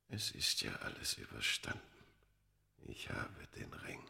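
soft whispered triumph; hushed whisper. Acted. The man holding the ring, softly whispering to himself. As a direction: breathe it out in relief.

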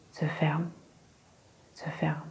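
"se ferment" repeated, dropping from soft into a hush; fading to a whisper. A narrator's reading. Hypnotist repeating "se ferment" to Lady Astwell, fading into a whisper. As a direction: let each "se ferment" fade away to nothing. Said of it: "Sa voix était comme un ronronnement monotone, doux, apaisant".

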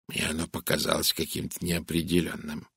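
low, soft, confidential. A narrator's reading. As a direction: drop your voice discreetly. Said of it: "понизив голос".

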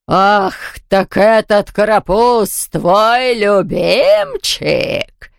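slow, drawn-out malicious mockery; spiteful ghost. A narrator's reading. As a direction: exhale the taunt with relish, very slowly. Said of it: "злобно выдохнул Яспера".

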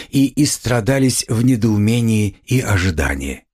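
ordinary delivery, nothing marked

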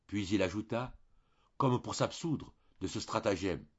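ordinary delivery

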